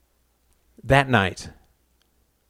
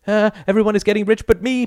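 high, hurried panicky outburst; mock panic; 10 semitones higher, 3 dB louder, faster than usual. A narrator's reading. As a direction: blurt it out fast in a high-pitched fluster.

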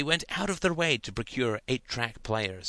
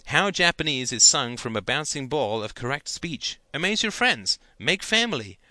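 higher, louder comic reading; mock reading; 5 semitones higher, 5 dB louder than usual. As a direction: relish the broken English. Ian mock-reading bad instructions.

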